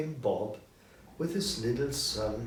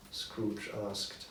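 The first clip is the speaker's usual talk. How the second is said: ordinary delivery, nothing marked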